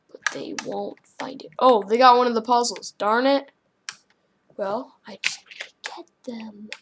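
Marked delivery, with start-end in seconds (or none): 0.1-1.5 secret whisper. Real, not acted. Sneaking from other players. 4.6-6.7 scheming whisper. Real, not acted. Sneaking while scheming.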